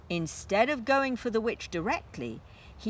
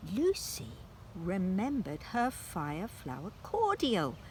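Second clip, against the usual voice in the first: ordinary delivery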